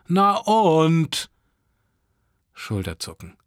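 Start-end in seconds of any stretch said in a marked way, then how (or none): 0.1-1.3 high, loud, drawn-out retort; defiant retort. A narrator's reading. Teen answers mother about neighbour boy, loudly defiant. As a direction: push it back louder, pitch well up. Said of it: "hab ich geknurrt".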